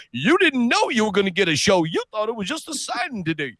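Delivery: high-pitched imitated teasing; high mock showman's quip, dropping in volume; playful showman. Acts out Carl's showman quip.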